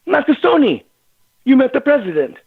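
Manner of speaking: high-pitched, emphatic announcement; impressed policeman. Retold or imitated. Policeman revealing he met the president, high and impressed. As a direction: imitate an amazed officer, pitched well up.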